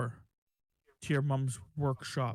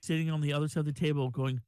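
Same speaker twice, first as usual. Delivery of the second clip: normal talking voice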